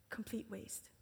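hushed disgust; whispered scorn. Retold or imitated. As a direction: breathe it out like a sneer. Disciples' scornful verdict.

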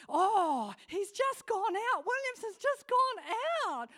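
husband excitedly reporting a cricket wicket. Retold or imitated. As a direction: blurt out the cricket news like a thrilled fan. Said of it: "So he".

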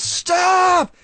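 high, drawn-out mock shout; imitated yell. Co-host acting out Cabana's yell at Marty, mock shout. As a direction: imitate a wrestler yelling to freeze his opponent. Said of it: "he yells".

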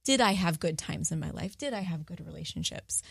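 ordinary delivery

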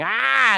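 very high, loud pained "Ah!"; child's hurt yell. Retold or imitated. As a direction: cry out like a scraped kid. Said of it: "One runs in shouting".

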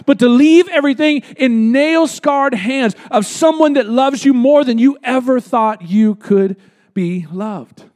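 normal talking voice

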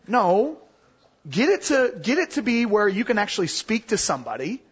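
usual speaking voice

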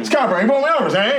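high, laughing gangster; a hint of fifties mobster; old-time gangster. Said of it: "you're talking like that".

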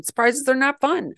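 normal talking voice

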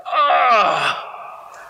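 Jesus sighing deeply in frustration; imitated frustrated sigh. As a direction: let out an exasperated groan. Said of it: "imagine it's like".

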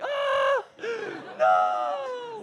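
very high, loud mock shriek with a gasp; piercing wail of disbelief; imitated horrified scream. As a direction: scream in hammed-up horror. Imitates sister screaming in horror.

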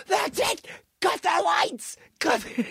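high mock-shouted command; Vince McMahon order. Boss orders the lights cut, high mock shout. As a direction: imitate a boss who has had enough.

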